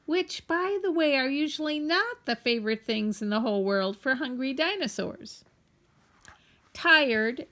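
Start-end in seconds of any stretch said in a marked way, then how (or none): none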